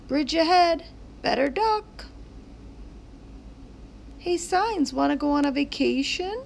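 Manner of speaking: put-on high childlike character voices; high excited kid voices in a story. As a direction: play the kids and sign kids eagerly. Sign characters talk.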